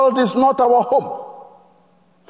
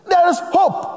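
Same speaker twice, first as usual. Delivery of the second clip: preaching shout; 7 semitones higher than usual. Real, not acted. Sermon climax, shouted.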